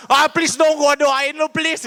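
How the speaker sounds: high, hammed-up begging; mock pushy pleading. Believer begging to pray for someone who refused, high mock pleading. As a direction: beg insistently as an example of what not to do. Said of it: "jangan maksa".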